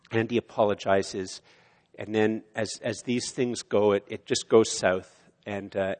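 ordinary delivery

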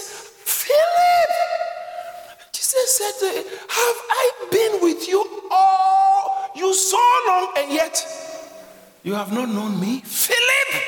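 high, loud, fervent preacher shout; full-force preaching. Acted. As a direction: thunder it out to the congregation.

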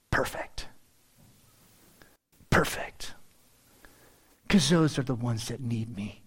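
hushed verdict. Acted. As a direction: drop into a whisper, unvoiced and deliberate. Voices Jesus's verdict on outcasts.

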